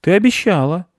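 high-pitched accusing protest; indignant boy. A narrator's reading. Boy reminds squirrel of her promise, indignant. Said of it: "возмутился он".

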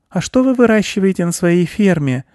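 put-on high little girl's voice; curious girl. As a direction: ask like an inquisitive child, pitched high. Girl character asks farmer.

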